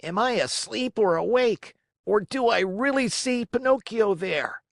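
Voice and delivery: high, disbelieving Harlequin voice; amazed puppet cry. A narrator's reading. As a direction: call out in wonder. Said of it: "yelling wildly".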